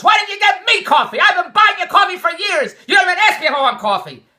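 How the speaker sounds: high, loud shouted imitation; imitated hollering. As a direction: shout it as a hollering wife would. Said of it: "and she hollers".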